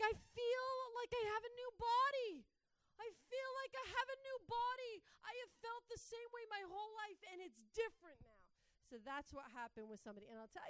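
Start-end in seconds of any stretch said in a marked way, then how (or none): 0.0-8.4 high, loud cry of astonished joy; high-pitched, full-volume overjoyed outburst; elated shouting. Retold or imitated. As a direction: shout it as an imitated ecstatic testimony. Acting out woman's joy at being freed.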